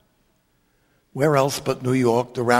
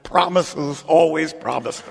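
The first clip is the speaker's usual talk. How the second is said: mock shout back; 3 semitones higher than usual. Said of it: "we all shout back".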